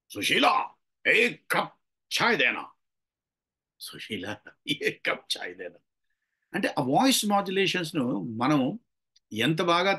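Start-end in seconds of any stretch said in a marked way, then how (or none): 0.1-2.7 calling out. Shown as a demonstration. Man asking Sushila for a cup of tea, calling out. Voice modulation demo, loud take. 3.8-5.8 very soft, low and slow; hushed request. Shown as a demonstration. Man asking Sushila for a cup of tea, hushed and slow. Voice modulation demo, hushed take.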